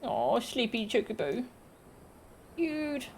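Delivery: cooing. Real, not acted. Cooing at a sleepy chocobo.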